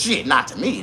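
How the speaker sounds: normal talking voice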